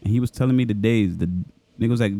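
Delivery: ordinary talk, nothing marked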